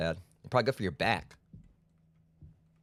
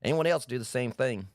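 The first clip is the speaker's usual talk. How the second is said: normal talking voice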